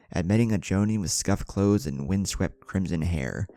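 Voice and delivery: ordinary delivery, nothing marked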